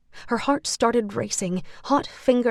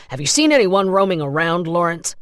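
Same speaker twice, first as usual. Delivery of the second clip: louder, fast interrogation; pressing question; 3 semitones lower, 7 dB louder than usual. A narrator's reading. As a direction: press him quickly. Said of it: "the second man said".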